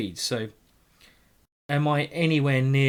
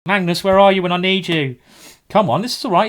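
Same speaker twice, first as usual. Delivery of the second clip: louder, higher plea to Magnus; exasperated calling out; 5 semitones higher, 9 dB louder than usual. Real, not acted. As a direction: cry out to him for help, half joking.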